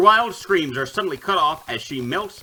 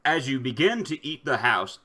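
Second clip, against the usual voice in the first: ordinary talk, nothing marked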